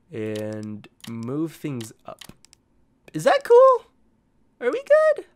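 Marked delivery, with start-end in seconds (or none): 3.1-5.3 very high squeaky question; high silly voice. Pretend. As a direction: squeak it in a tiny voice.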